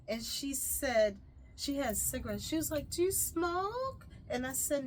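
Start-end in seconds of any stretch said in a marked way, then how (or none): 2.9-4.1 high, slow, drawn-out question; slowly lilting, pitched-up question; imitated nosy offer. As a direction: mimic a stranger asking, pitch lifting at the end. Imitates lady offering cigarette.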